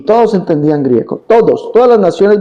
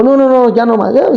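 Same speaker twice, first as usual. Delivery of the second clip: higher mock no no no; fed-up woman; 6 semitones higher than usual. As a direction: imitate her cutting the talk off. La señora se rinde y se va. (imitando a la señora que ya no quiere oír)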